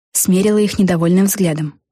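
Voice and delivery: usual speaking voice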